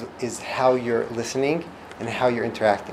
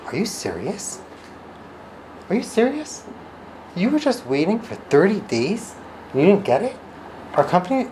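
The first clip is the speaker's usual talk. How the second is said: much higher, astonished are you serious; shocked sympathy; 8 semitones higher than usual. Retold or imitated. Service agent reacting to an upset caller, shocked empathy. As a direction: gasp along with the caller. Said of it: "the customer service goes".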